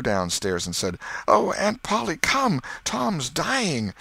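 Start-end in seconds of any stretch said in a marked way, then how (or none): 1.3-3.9 very high, urgent cry for help; frantic boy, pitch soaring; panicked. Acted. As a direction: call the aunt in sheer alarm. Sid runs for help.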